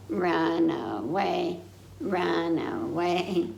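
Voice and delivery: drawn out, very slow call; slow playful run away. Acted. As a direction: stretch each word slowly. Game ending line.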